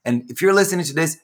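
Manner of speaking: ordinary talk, nothing marked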